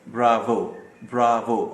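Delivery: "ब्रावो" with a lip-teeth v and a rolled, heavy r. Shown as a demonstration. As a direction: say "ब्रावो" with a true English v. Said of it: "ऊपरी दांतों को निचले होंठ से मिलाएंगे".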